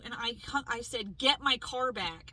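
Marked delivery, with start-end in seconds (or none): 1.2-2.3 louder, slow angry command; retold loud demand. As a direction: spell it out at Joe, furious. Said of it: "I was, I was loud".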